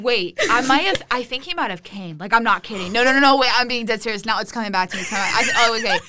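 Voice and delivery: ordinary talk, nothing marked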